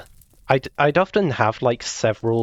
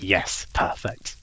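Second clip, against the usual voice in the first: whispered; 3 semitones lower, 5 dB quieter, much slower than usual. Retold or imitated. Imitating self while building.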